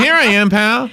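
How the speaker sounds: high-pitched talking blackbird saying hello; chummy bird. Acted. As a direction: announce yourself as a perky little bird. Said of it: "he said".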